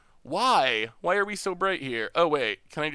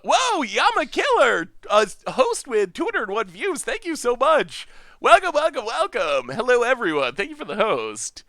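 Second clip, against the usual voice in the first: higher, louder burst of welcome; excited streamer shout-out, pitched up; excited thanks; 6 semitones higher, 4 dB louder than usual. Real, not acted. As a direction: greet the new arrivals with delighted surprise. Thanking viewers for a surprise host.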